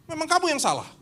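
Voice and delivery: high, dismissive put-down; arrogant leader. Retold or imitated. Stubborn pastor scolding his leaders, high and dismissive. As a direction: talk down to them, sure you're right.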